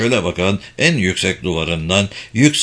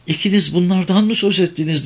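higher-pitched surprised question; astonished interruption; 9 semitones higher than usual. A narrator's reading. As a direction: cut in, amazed, pitch lifted. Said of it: "sözünü kesti hayretler içindeki Kamer". (hayretle söz keserek)

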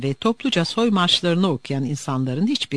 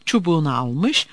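plain reading voice

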